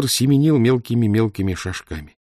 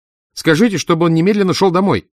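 higher, louder, annoyed woman's demand; irritated woman; 9 semitones higher, 6 dB louder than usual. A narrator's reading. Mother on the phone demanding her son come home, irritated. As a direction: snap at the boy on the phone. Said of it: "раздражённо заговорила женщина".

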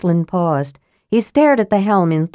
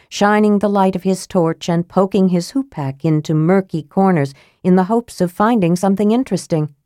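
ordinary delivery, nothing marked

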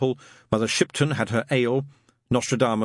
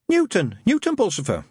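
high, polite, timid lad; nervous young man; 11 semitones higher than usual. Acted. Newton asking Shadwell about the ad, timid and high. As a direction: ask shyly, voice up.

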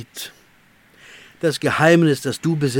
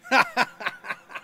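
shrill triumphant cackle; demonic high laugh. Acted. Tempter laughs as he reveals the temptation.

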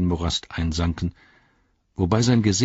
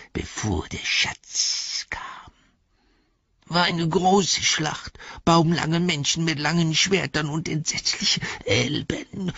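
langsam, gehaucht und jammernd, eine Kreatur; Gollum-Stimme; 7 semitones higher, slower than usual. A narrator's reading. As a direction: raune es wie ein gequältes Wesen. Gollum erzählt von der Schlacht. (Gollum erzählt flüsternd und langsam von der alten Schlacht)

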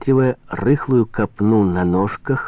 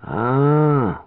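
long, higher "ah" of recognition; drawn-out reply; 5 semitones higher than usual. A narrator's reading. Trader learning who has come, drawled "ah". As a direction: stretch out one dawning sound. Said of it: "протянул торговый".

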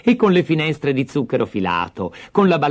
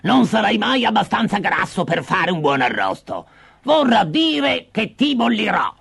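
high-pitched witch grumble turning to threat; complaining witch; 9 semitones higher than usual. Acted. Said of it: "si lamentò". (strega che si lamenta e minaccia)

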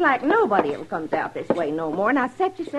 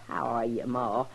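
lower, lightly voiced grown son; a hint of a man's deeper voice; son's voice; 12 semitones lower, 7 dB quieter than usual. Mother quotes her son's greeting.

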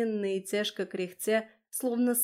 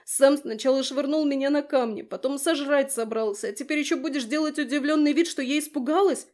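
higher, louder indignant outburst, speeding up; indignant rant; 5 semitones higher, 6 dB louder than usual. Acted. Kira rails at her husband for frightening her, indignant and loud. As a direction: pour out every grievance at once.

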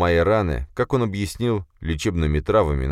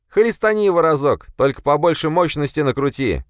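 high, loud excited shout; 11 semitones higher, 5 dB louder than usual. A narrator's reading. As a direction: yell the idea eagerly. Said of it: "крикнул ему щуплый".

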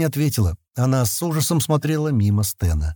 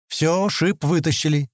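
higher, slow, contented delivery; satisfied tone; 6 semitones higher, slower than usual. A narrator's reading. Sten after pulling the thorn out, satisfied tone. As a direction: announce it with mild satisfaction, unhurried. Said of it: "довольным тоном сказал Стэн".

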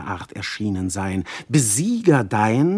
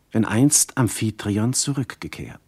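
normal talking voice

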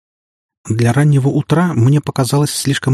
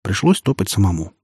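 plain reading voice

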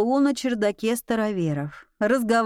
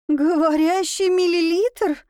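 frightened cry; 6 semitones higher than usual. A narrator's reading. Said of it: "Вновь закричав".

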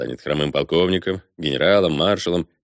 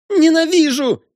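very high, loud shriek of hate; shrill desperate cry; desperate girlish scream; 6 dB louder than usual. A narrator's reading. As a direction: scream it as a despairing girl. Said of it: "Отчаянный девичий крик".

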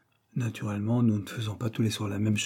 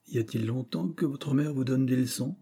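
ordinary delivery

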